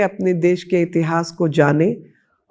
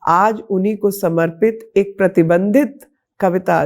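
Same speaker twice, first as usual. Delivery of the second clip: usual speaking voice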